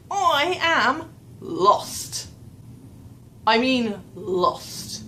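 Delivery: "lost" drawn out very slowly; emphatic LOST. Real, not acted. Storyteller stressing how badly lost she got, "lost" dragged out. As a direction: stretch out "lost" for comic emphasis.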